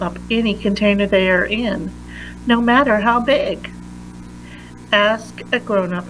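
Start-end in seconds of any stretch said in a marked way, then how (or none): none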